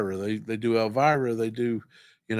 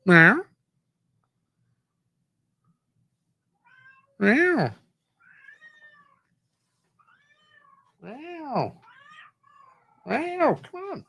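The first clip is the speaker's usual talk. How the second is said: meowing to the cat; much slower than usual. Pretend. Calling the cat to come.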